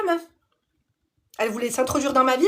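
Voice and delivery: normal talking voice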